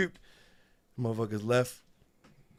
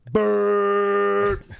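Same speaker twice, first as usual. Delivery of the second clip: mock rapper noise; 9 semitones higher, 6 dB louder than usual.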